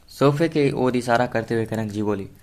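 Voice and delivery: usual speaking voice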